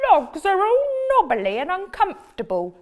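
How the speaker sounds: high, loud protesting frog voice; whiny frog. Acted. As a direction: complain like a stubborn little frog. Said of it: "said the frog".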